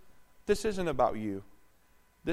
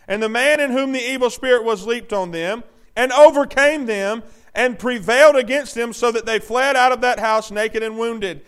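loud, raised-pitch preaching; forceful scripture reading; 8 semitones higher, 12 dB louder than usual. Acted. Preacher reading scripture to the congregation, loud and forceful. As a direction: read it out at full force.

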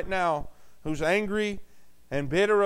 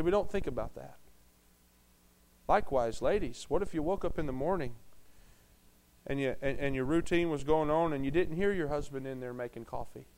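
much softer and lower, tender and somber; quiet solemn sermon moment; hushed and grave; 5 semitones lower, 8 dB quieter than usual. Acted. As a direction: paint the loss gently. Imagining a spouse dying.

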